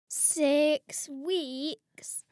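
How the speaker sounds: slow, drawn-out repeat; slowly. Acted. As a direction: let "Six weeks" sink in slowly. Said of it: "Mum repeated slowly".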